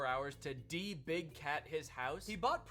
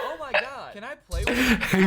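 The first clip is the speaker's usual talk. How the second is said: higher and louder, gushing over the tiger; mock excited girl; 6 semitones higher, 15 dB louder than usual. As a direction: play an excited girl begging to pet it. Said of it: "girls would go".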